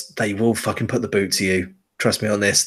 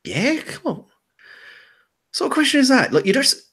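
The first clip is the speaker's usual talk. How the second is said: put-on annoyed twelve-year-old. Girl answers player's question.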